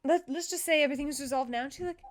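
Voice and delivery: usual speaking voice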